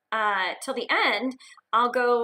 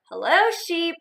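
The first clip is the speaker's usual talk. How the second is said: higher friendly hello; cheerful duck greeting; 6 semitones higher, 5 dB louder, slower than usual. Acted. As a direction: call hello to the sheep.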